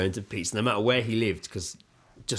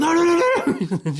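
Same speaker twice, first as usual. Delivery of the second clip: high, loud mock panic no; panicked shout; 6 dB louder than usual. Parents stopping the painted child from moving, high panicked shout. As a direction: shout her down before she touches anything. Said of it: "We're like".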